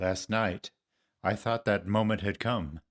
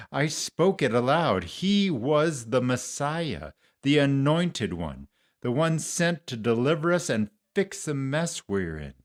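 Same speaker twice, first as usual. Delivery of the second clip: raised, higher, emphatic declaration; fervent proclamation; 5 semitones higher, 3 dB louder than usual. Acted. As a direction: proclaim it with proud conviction. Disciple proudly proclaims the Messiah.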